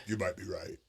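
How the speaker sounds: grudging boss. Retold or imitated. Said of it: "And he's like".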